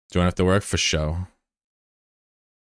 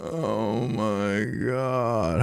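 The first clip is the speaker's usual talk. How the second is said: dejected sigh; 4 semitones higher, 7 dB quieter, much slower than usual. Real, not acted. Dejected after loss.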